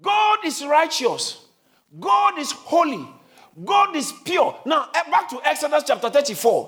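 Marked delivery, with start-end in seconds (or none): 0.0-4.6 "God is righteous" drawn out slowly, each word weighted; slow emphatic proclamation. Shown as a demonstration. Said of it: "I want you to shout it louder".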